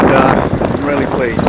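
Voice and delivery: ordinary talk, nothing marked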